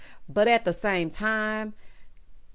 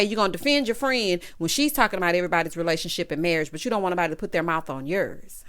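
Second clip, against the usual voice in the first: usual speaking voice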